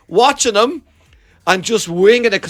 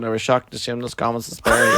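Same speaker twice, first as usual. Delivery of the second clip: droning priest; 6 semitones lower, 4 dB quieter than usual. Retold or imitated. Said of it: "the priest just kept going".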